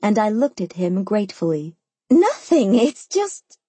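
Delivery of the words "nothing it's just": higher, halting objection; defensive, breaking off unfinished; flustered protest. A narrator's reading. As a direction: protest weakly, pitched up. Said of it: "she said again".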